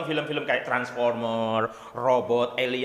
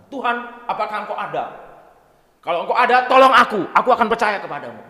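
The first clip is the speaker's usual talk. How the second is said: higher, louder cry for help; desperate plea; 6 semitones higher, 11 dB louder than usual. Acted. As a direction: beg God from the cliff edge. Atheist hanging, begging God. (memohon dengan putus asa)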